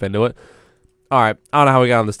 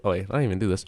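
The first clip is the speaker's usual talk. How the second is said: ordinary delivery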